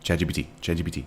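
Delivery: quick tongue-twister attempt at Chat G P T; rapid repetition. Shown as a demonstration. Streamer testing if they can say it, rapid-fire. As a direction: rattle off Chat G P T fast, slurring the letters together. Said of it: "see, I can't say that".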